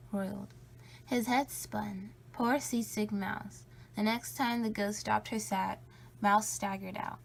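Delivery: usual speaking voice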